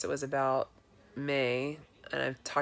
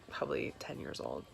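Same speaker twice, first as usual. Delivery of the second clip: whisper; 12 semitones lower, 9 dB quieter than usual. Real, not acted. Confessing her bathing suit's age.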